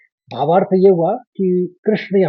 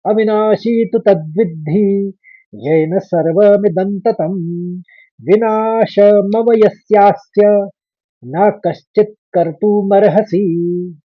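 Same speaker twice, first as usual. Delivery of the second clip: whole verse chanted slowly and a bit louder; slow shloka recitation; 3 semitones higher, 4 dB louder, slower than usual. Shown as a demonstration. Teacher recites the whole verse slowly for students. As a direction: recite it reverently, every syllable clear. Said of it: "एक बार और".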